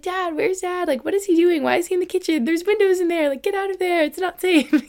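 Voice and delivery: put-on high, anxious little-girl pleading; scared child calling for dad. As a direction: fret like a frightened kid in a storm. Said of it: "I was like".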